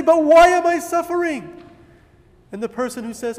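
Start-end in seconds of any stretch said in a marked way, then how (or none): 0.0-1.6 loud, high plea of why; anguished cry. Retold or imitated. The suffering person crying out to God, loud and high. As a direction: cry out the question in pain. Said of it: "you're gonna say".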